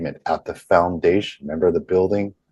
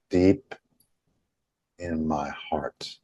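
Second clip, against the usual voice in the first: slow and deliberate; slower than usual. Shown as a demonstration. Leading followers to say along, slow and deliberate. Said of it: "the slowness, the deliberateness in how you say that".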